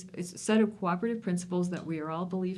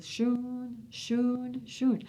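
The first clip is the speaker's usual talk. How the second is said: high, drawn-out shoon snores; imitated armadillo snoring; 6 semitones higher, much slower than usual. Shown as a demonstration. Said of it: "an armadillo snoring rep-, goes".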